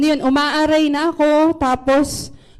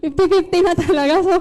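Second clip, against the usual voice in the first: rueful laugh through the words, quick; 4 semitones higher, much faster than usual. Real, not acted. Laughing as she tells the church she nearly died. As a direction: laugh it off while rushing the line. Laughing about near death.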